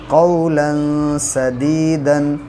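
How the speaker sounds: slow, drawn-out tajweed recitation of कौलन सदीदन; Quranic recitation. Shown as a demonstration. Teacher demonstrating tajweed to students, slow recitation. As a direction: recite कौलन सदीदन slowly with clear noon sakin. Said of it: "तनवीन के बाद सीन आया".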